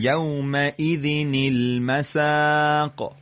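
slow, with المساق stretched on "saaa"; elongated recitation. Shown as a demonstration. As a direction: draw out the long vowel of المساق. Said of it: "قشنگ کشش بدید".

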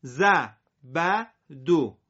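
زبدو split slowly into parts; very slow separated reading; slow syllable by syllable. Shown as a demonstration. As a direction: read زبدو apart, slowly. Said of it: "سر هم".

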